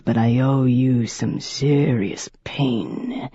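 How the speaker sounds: slow, breathy whispered threat; menacing whisper. A narrator's reading. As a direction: hiss the threat close to her ear.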